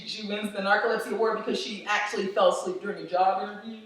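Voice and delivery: usual speaking voice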